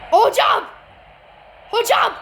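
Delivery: loud call to the ref. Real, not acted. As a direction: shout for the referee's attention. Calling out to the referee. (hocaya seslenerek bağırış)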